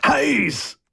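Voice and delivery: high, pained exclamation; scalded yelp. A narrator's reading. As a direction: cry out from a burn. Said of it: "diesmal allerdings vor Schmerz".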